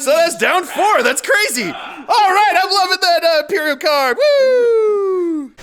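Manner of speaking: high, loud gleeful cheering; excited. Real, not acted. Game host celebrating big damage with a loud whoop. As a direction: whoop it up over the dice.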